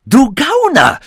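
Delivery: high, loud screamed insult. A narrator's reading. Professor threatened with a beating, screaming back. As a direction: scream the insult at full pitch. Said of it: "schrie er noch lauter".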